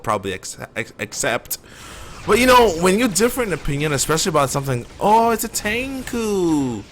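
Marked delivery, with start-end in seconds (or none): 5.0-6.8 high, slow, playful call; excited. Real, not acted. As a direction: sing out the enemy's name. Spots an enemy in game.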